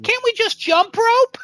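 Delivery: very high, teary little toy plea; crying elephant. Retold or imitated. The stuffed elephant pleading as they drag it, high teary whimper. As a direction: whimper the request like a hurt toy.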